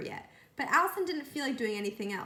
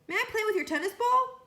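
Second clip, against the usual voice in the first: high, eager asking voice; lightly voiced yard teacher; 11 semitones higher, 5 dB louder than usual. A narrator's reading. Lewis asking Allison for her ball, bright high voice. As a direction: ask sweetly, a touch higher.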